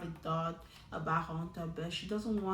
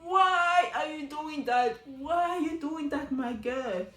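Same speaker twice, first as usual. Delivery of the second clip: exasperated why; 6 semitones higher, 5 dB louder, slower than usual. Real, not acted. Exasperated at contestant on TV.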